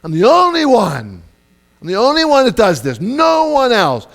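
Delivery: high, loud martyr's complaint rising to a shout; self-pitying complaint. Retold or imitated. Preacher mimicking his own self-pitying rant. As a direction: cry out that you do it all. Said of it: "I know I say it".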